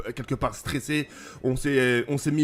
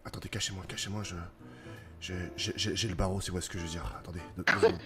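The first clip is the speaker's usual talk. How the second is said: low, hushed and flustered, stumbling over words; hushed aside; 6 semitones lower, 7 dB quieter than usual. Pretend. Flustered man confiding in his captain, hushed aside. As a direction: keep it down, only for the captain's ears.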